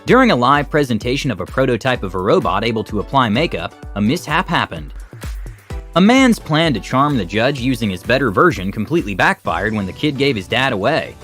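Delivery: ordinary delivery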